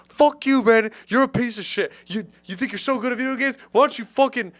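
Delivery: high, loud furious rant; angry rant. Retold or imitated. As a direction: rant like a sore loser. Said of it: "he was getting so pissed".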